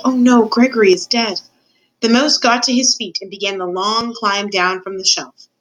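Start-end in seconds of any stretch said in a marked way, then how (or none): none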